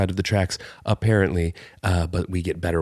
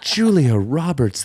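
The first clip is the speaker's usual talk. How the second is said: slow, higher laughing oath; exclamation; 6 semitones higher, slower than usual. Shown as a demonstration. Trying out the new exclamation on ribs, slow amused oath. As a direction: draw the name out as a swear. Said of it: "using that as an exclamation".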